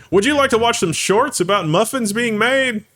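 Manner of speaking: high, chirpy mock sales pitch; pushy app voice. YouTube pushing shorts on a searching user, mock-chirpy.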